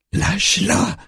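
very high strained cry, volume held back; breaking-voice scream. A narrator's reading. Parissa screaming to free her sister, very high cry. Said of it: "crie-t-elle tellement fort que sa voix se brise".

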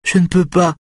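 high, tearful whimper; reniflement plaintif. A narrator's reading. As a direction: refuse weakly, close to tears. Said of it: "renifle-t-elle". (plainte aiguë et larmoyante)

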